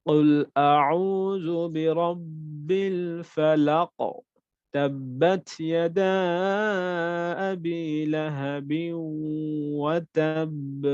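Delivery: slow chant, strong bounce on the final letters; big qalqala recitation. Shown as a demonstration. Teacher reciting short surahs with big qalqala. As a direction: recite slowly, hitting each end letter hard. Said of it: "আর এখানে আমরা খুব বড়ভাবে করব".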